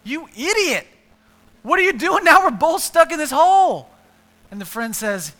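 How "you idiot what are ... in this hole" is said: much higher, loud scolding yell; exasperated shout. Acted. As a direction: yell at the friend in disbelief. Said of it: "and he's like".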